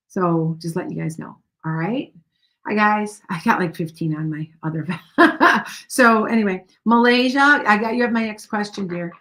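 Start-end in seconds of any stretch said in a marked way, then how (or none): 4.9-5.8 laugh. Real, not acted. Laughs at many questions waiting.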